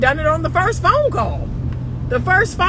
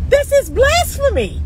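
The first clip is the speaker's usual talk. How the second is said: mock outrage; 11 semitones higher than usual. Real, not acted. Outraged at women's claim.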